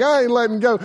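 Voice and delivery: high, pushed refusal; cling on and shout it; defiant cry. Retold or imitated. Stubborn teen shouting from the tube.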